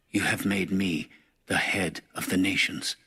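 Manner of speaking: ordinary delivery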